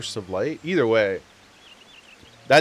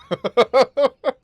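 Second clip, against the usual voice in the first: laughing; 12 semitones higher, 8 dB louder than usual. Real, not acted. Laughing after the impression.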